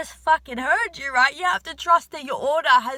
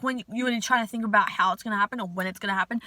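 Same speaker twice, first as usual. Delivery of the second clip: usual speaking voice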